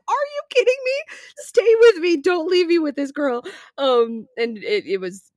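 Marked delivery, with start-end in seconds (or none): 0.1-3.4 high, loud pleading outrage; abandoned friend yelling at roommate outside the bar, much higher and louder; retold desperate anger. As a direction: beg the roommate not to leave, furious. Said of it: "And I was like".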